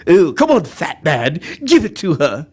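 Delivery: haughty cartoon baby; Stewie. Baby urges fat man on, haughty voice. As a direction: goad him like a scheming infant. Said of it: "That's Stewie".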